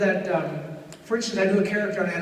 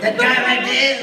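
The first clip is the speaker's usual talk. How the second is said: put-on high, loud accented voice; little accented sidekick voice; Hervé Villechaize impression; 5 semitones higher, 7 dB louder than usual. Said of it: "Hervé Villechaize".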